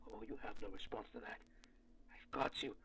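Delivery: soft, fast, smug taunt; quiet gloating. Acted. Archivist quietly taunts the creature that has no reply, gloating. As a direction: gloat under your breath.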